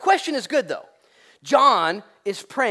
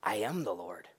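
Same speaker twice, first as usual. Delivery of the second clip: quiet declaration; 9 semitones lower, 10 dB quieter than usual. Retold or imitated. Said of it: "Jesus comes up and says".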